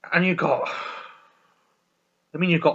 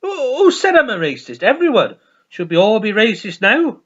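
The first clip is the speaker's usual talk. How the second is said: high loud indignant mock voice; hammed-up outraged priest; character voice; 7 semitones higher, 7 dB louder than usual. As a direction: protest loudly in a raised voice. Acted Father Ted dialogue.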